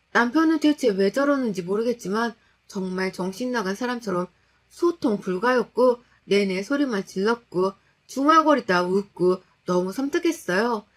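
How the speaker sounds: plain reading voice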